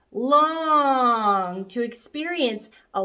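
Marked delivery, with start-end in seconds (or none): 0.1-1.6 "long" held long and smooth; a long sound; drawn-out long. Shown as a demonstration. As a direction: stretch the word long out slowly. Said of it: "drawing the student's hand across".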